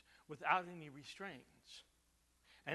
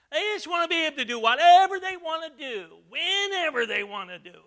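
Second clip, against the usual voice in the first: much higher, louder outburst; preaching at full force; 10 semitones higher, 17 dB louder than usual. Acted. Pastor denouncing careless freedom, loud. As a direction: hammer the words whatever and whenever.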